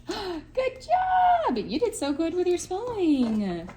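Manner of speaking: high-pitched gushing praise after a gasp; excited praise. Real, not acted. Praising child's finished spelling.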